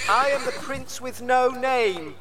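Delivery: put-on proud young prince, high and steady; mysterious prince. A narrator's reading. A young prince proudly tells the crowd his title. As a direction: announce yourself as the nameless prince. Hans declares himself at race.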